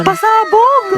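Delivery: high, showy surprise cry; big theatrical reveal; hyped announcement. Acted. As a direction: call it out way up high. Announcing a surprise flower delivery.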